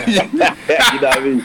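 laughing hard, high; laughing through the words. Real, not acted. Laughing at the Jamaican accent joke.

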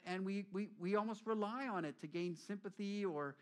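normal talking voice

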